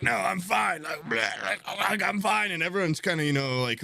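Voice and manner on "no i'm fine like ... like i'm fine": high, strained insisting voice; sick passenger insisting. Retold or imitated. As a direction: insist you're fine while sick. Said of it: "She's like".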